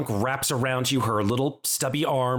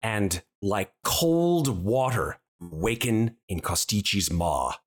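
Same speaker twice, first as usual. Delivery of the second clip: slow, low narration with measured pauses; game master scene setting; 4 semitones lower than usual. Acted. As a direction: gently paint an afterlife vision.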